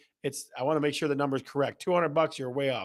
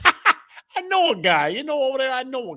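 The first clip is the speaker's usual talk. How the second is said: jokey wise-guy line, much higher and slowed; silly put-on voice; 9 semitones higher, 4 dB louder than usual. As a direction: stretch it out high and goofy through a laugh. Joke repeated in a silly voice.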